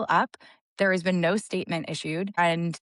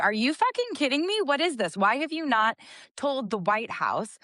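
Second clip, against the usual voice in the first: higher-pitched incredulous questioning; indignant disbelief; 6 semitones higher than usual. Retold or imitated. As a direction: demand an explanation in disbelief. Retells her outraged morning reaction.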